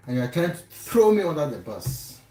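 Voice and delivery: usual speaking voice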